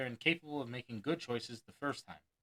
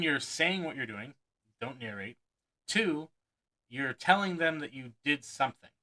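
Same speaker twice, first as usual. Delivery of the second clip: usual speaking voice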